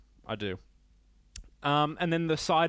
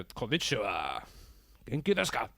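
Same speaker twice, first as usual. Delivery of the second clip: mock Japanese accent. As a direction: put on an exaggerated Japanese accent. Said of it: "I don't know why I have to do the accent".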